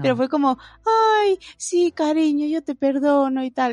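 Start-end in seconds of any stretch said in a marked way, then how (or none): 0.8-3.4 high, gushing melted-dad voice; melting dad. Retold or imitated. Dad melting when his little daughter asks forgiveness.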